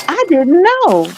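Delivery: high, drawn-out mock protest; mock innocence. Quoting channels that claimed ignorance, mocking protest. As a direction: stretch it out high in feigned innocence.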